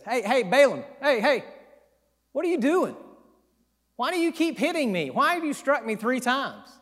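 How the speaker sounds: high, pleading donkey voice; a wronged donkey asking why it's being hit; talking donkey. Retold or imitated. Preacher voicing the donkey pleading with Balaam, high and hurt. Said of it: "God opens up the mouth of the donkey and says".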